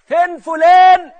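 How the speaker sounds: high, drawn-out shout; loud demanding call. Acted. Cheated crowd looking for the swindler, loud drawn-out demand. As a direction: call out for a missing man.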